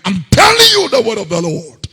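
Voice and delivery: thundering pulpit quote; fiery preaching. Acted. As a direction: hammer the verse home. Quoting scripture at full force.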